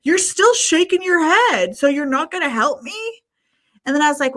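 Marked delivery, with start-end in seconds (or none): 0.0-3.2 mock high whiny stranger, slowed; hammed-up high voice for the pleading guy. Stranger at the gas pump pleading with her, in a high mock voice. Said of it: "And he's like".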